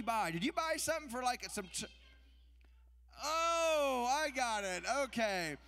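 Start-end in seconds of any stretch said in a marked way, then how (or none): none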